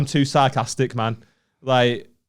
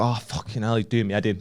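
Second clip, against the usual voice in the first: softer, lower grumble; muttered exasperation; 4 semitones lower, 6 dB quieter than usual. Retold or imitated. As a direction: grumble under your breath, fed up. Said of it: "you know when someone's pissing you off".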